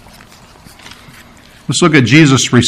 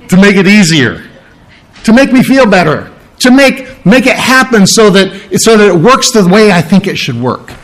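higher-pitched, insistent mock pleading; acted-out whiny insistence; self-centred demand; 6 semitones higher than usual. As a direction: demand it your own way. Mimics self-centred demands on God.

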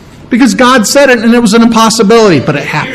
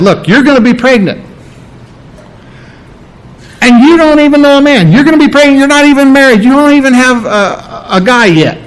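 angel's announcement; 7 semitones higher than usual. Acted. Angel Gabriel to Mary, announcing the pregnancy: excited raised voice. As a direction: play Gabriel breaking astonishing news, pitched up.

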